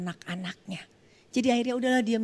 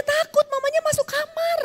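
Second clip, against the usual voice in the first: high, tearful telling; emotional crying; 4 dB louder than usual. Real, not acted. Pastor telling the congregation of the frightened mother, tearful. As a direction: voice catching with emotion.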